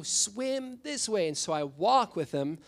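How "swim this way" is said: much higher, very slow insistent call; repeated call. Retold or imitated. Pastor repeats the call to a student who didn't hear, higher and slower. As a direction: say it again, drawn out, so he finally hears.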